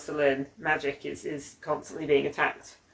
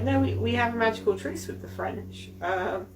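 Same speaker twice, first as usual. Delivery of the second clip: quoted English locals, voice raised higher; lightly put-on voice, higher pitch; mock indignant locals; 7 semitones higher than usual. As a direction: play the offended Englishman protesting their innocence. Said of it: "They're like".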